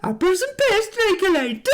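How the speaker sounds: high-pitched, laughing bad Cockney; mock Cockney accent. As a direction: squeal it in a botched Cockney accent, mimicking. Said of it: "What the fuck accent was that".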